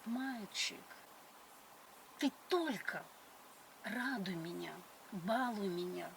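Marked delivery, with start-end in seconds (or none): none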